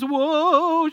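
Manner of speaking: loud, high-pitched mock wail; imitated crazy noise. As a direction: imitate a little girl's hysterical whoop. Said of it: "she's making crazy noises".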